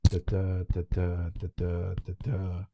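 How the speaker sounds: "da, da" tapped out in even metrical pairs; iambic rhythm. Shown as a demonstration. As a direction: beat out each "da, da" as one poetic foot. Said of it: "stressed syllable followed by an unstressed syllable".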